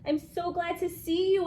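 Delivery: squeaky hamster. A narrator's reading. Hamster squeaking gladly to the teacher. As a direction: squeak it happily. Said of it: "I squeaked".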